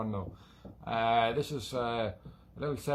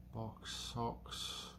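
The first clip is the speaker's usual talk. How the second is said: breathy half-whispered rhyme, much softer; whispered aside; 12 dB quieter than usual. Pretend. Limerick player whispering a rude rhyme to viewers, hushed whisper. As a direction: slip the cheeky word out in a whisper.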